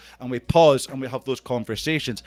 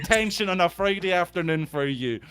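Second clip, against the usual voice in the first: playful schoolteacher telling-off, pitched up; teasing, higher-pitched telling-off of a latecomer; mock teacher scolding; 6 semitones higher than usual. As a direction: pretend to hand out detention.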